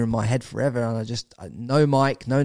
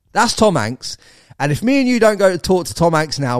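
retold mate pressing hard, higher and louder; urgent insistence; 6 semitones higher, 7 dB louder than usual. Mate urging him to go talk to Tom Hanks, excited insistence. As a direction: push him to go now, this is the chance.

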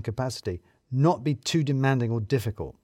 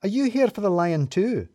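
excitable; 8 semitones higher than usual. A narrator's reading. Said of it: "Sue seemed a lot more excitable".

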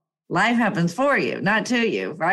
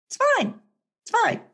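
forced calm; 13 semitones higher than usual. Retold or imitated. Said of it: "But I was like".

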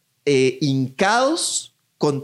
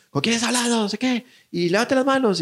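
cop voice; 8 semitones higher, faster than usual. Retold or imitated. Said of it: "yo es como".

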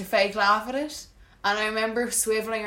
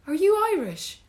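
high-pitched chirpy imitation; cheery stranger; 11 semitones higher than usual. Stranger approaches the crying woman, chirpy and high. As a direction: pipe up brightly like an intrusive stranger.

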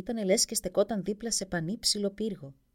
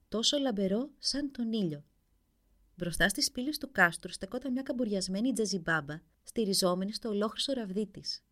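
ordinary delivery, nothing marked

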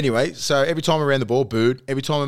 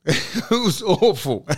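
laughing; 5 semitones higher, much slower than usual. Real, not acted.

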